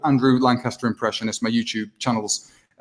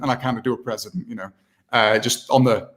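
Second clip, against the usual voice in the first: ordinary talk, nothing marked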